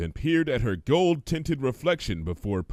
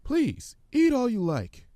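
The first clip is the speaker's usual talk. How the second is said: gentle, high and timid. Acted. Fluttershy voiced in story.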